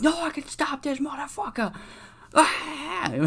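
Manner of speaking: squeaky high mock hype; hyped-up sidekick. Sidekick hyping up the champ, squeaky high mock voice. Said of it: "He's like".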